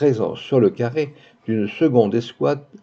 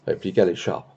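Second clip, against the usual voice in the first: ordinary delivery, nothing marked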